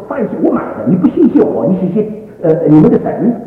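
high-pitched, loud imitation of an angry father; indignant scolding. Father rebukes son for thanking God, indignant. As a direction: scold like an offended provider.

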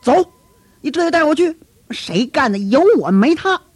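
high, loud and hot-headed; fired-up anger. Acted. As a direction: Peter bursting to go and fight. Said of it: "给彼得急的".

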